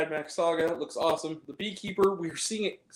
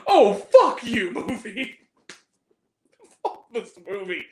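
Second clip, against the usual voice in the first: loud, laughing exasperation; fed up and cracking up; laughing outburst; 5 semitones higher, 7 dB louder, slower than usual. Real, not acted. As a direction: laugh it off in disbelief. Reacting to dumb ending.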